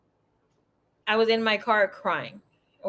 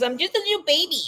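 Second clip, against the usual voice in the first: put-on higher little-baby whine; mock baby voice, much higher; 8 semitones higher, faster than usual. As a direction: play the helpless baby. Mock self-pity.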